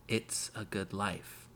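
soft, slow and hushed; softly, slowly, holding back; quiet. A narrator's reading. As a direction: say it barely above a breath, slowly. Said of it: "Her voice was quiet".

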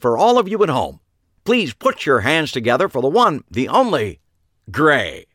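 loud, high showman intro; TV host announcing. Acted. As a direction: hype the crowd like a TV host. TV host announces live show.

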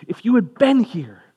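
high, loud grieving protest falling away on "here"; distraught reproach. Acted. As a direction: cry it out as someone bereaved. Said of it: "the distraughtness? You've lost someone you love".

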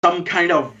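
usual speaking voice

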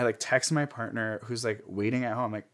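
ordinary delivery

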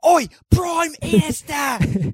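high, loud, slow shouted greeting; hammed-up Aussie yell; mock Aussie shout. Mock Australian call out.

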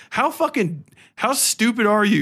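ordinary delivery

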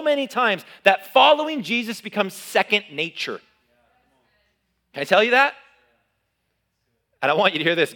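loud, high, driving preacher climax; a fired-up pastor shouting the point; preaching at full force. Acted. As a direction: build the room up and hammer it home. Preacher builds to a peak.

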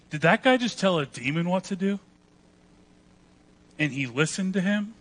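imagined astonishment, rising in pitch; mock disbelief. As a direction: ask it like a stunned bystander. Imagined stunned onlooker's reaction.

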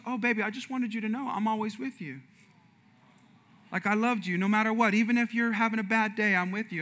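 imitated loving, calming wife; tender reassurance. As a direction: gently comfort an upset husband. Voices wife reassuring him after his outburst.

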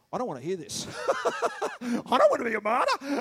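whiny, laughing mock complaint; mock reluctant churchgoer. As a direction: play the scared listener walking out. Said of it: "they go".

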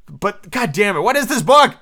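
high, loud, baffled outburst; exasperated. Real, not acted. Reader fed up with the confusing book, exasperated. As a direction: let the bafflement burst out, voice climbing.

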